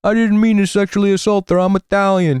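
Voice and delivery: high, stammering Italian-American caricature; Cuomo impression. Streamer mocking the Italian politician's excuse, hammed-up impression. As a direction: plead innocence in a hammed-up, high-pitched voice. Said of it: "You know the Italian pervert one? He was like".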